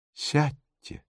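soft, sorrowful request; hushed, mournful invitation; sad. A narrator's reading. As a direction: ask very gently and sadly. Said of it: "грустно проговорила она".

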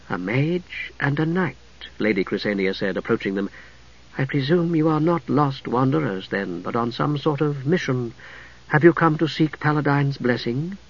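a calm holy woman greeting strangers softly; gentle priestess voice. A narrator's reading. Blind priestess Chrysania greeting the mage and knight, soft and serene.

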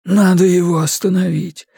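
plain reading voice

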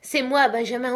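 frantic father shouting at the locked door; desperate cry. A narrator's reading. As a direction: beg to be let in. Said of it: "s'écriait".

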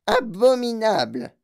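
loud, slow, drawn-out outrage; outraged old woman. Acted. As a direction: bellow it in horror. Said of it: "dit grand-maman Georgina".

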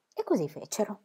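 ordinary delivery, nothing marked

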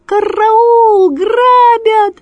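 very high, loud crow screech; piercing cry of the robbed; cry for help. Acted. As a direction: shriek the alarm.

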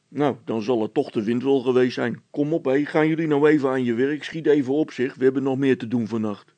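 a policeman brushing it off; gruff officer. A narrator's reading. (stoere veldwachter, wuift het weg)

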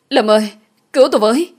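high, loud cry for rescue; screaming for help. A narrator's reading. Trapped woman calling her rescuer outside, screaming for help. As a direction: call out desperately with all your strength. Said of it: "dùng hết sức rồi gào lên".

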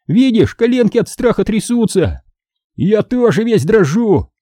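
high teasing pretend fright; mock fear. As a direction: sneer in a high, stretched mock-scared voice. Boys mock fear to tease. (притворный испуг, с издёвкой)

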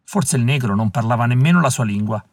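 usual speaking voice

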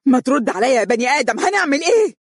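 very high, loud shriek; frantic demand shouted; screaming madly. Acted. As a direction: scream at him like she's lost her mind. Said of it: "صارخة بجنون".

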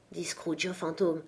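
plain reading voice

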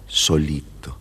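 ordinary delivery

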